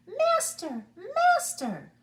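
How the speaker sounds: very high shrill screams; screaming harp. A narrator's reading. Magic harp screaming to wake the giant, very high. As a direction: scream for your master. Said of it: "when it screamed".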